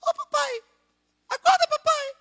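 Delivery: imitating daughter Maria waking dad; little girl voice. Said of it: "escuto uma vozinha falando assim". (imitando a vozinha da filha, bem aguda)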